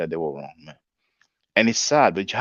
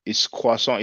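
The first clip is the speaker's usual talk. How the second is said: usual speaking voice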